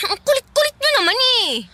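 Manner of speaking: high, exasperated complaint; exasperated whine. Acted. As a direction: whine that she's had enough. Daughter fed up with nagging.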